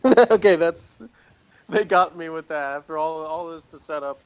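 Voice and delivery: bursts out loud, high and laughing; laughing outburst. Real, not acted. Podcaster caught out by a silly episode setup, laughing loudly. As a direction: caught off guard by the joke, laughing it out.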